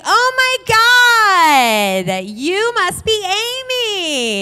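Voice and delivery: very high, loud, drawn-out gush; over-friendly greeting. Retold or imitated. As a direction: play real friendly, hammed up as a mock greeting. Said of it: "real friendly".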